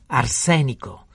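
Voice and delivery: slow, loud, ominous announcement; angry revelation. A narrator's reading. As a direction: spell out the danger loudly and slowly. Pharmacist reveals the poison, furious. (annuncio rabbioso e scandito)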